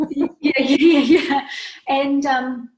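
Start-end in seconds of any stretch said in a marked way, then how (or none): 0.0-1.5 real laughter through louder yeahs; laughing yeahs. Real, not acted. Guest laughing as host promises to come back, loud laughing yeahs. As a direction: agree while still laughing. Laughing after host's tease.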